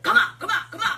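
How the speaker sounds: high, loud barked "come out"; mock shouted command. Imitating Kim casting out a demon, shouted. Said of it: "Kim will be like".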